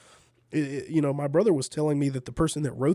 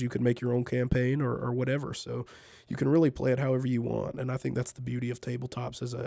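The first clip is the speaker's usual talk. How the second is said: ordinary delivery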